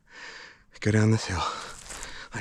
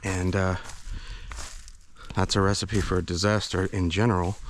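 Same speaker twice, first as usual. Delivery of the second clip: normal talking voice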